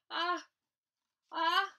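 loud, rising "ah" before a sneeze; acted pre-sneeze "ah"; sneeze build-up. A narrator's reading. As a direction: gasp up toward a huge sneeze.

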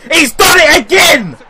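real high yell of joy at the goal; goal celebration shout. Real, not acted. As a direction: scream it out as the ball goes in.

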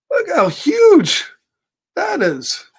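high, slow, amazed cry of wonder. Real, not acted. Showing kids a page, an amazed cry. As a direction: gasp at the huge creature and marvel.

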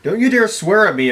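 usual speaking voice

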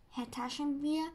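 plain reading voice

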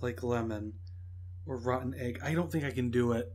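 low, very soft reluctant mumbling; quiet dread. Real, not acted. Gamer dreading the next rotten egg, hushed reluctance. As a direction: shrink from it, hushed and deep in the throat. Dreading another bad jelly bean.

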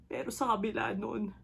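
ordinary delivery

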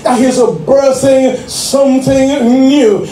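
rising, full-voiced preaching over the organ. Pastor preaching to the church, at full force. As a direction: preach it out to the congregation, building.

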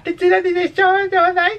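put-on very high, drawn-out girly voice; vocecilla de chica. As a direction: imitate a cutesy anime girl. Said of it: "ya pone una vocecilla un poco de chica". (vocecita aguda de chica)

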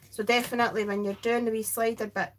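ordinary talk, nothing marked

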